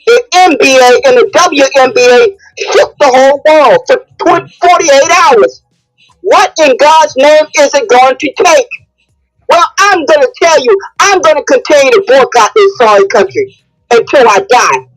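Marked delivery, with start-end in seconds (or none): none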